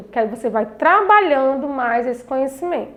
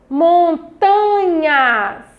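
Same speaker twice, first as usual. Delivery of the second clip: MONTANHAS very slow, TA held long; syllable stress demo; 3 semitones higher, 3 dB louder, much slower than usual. Shown as a demonstration. Teacher shows children the tonic syllable, Montanhas drawn out on TA. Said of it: "ler com maior intensidade a palavra".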